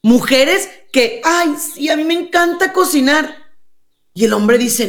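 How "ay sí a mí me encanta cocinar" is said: high-pitched, put-on sweet boasting; mock eager girlfriend. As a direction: imitate a woman out to impress her suitor. Acted woman boasting while courting.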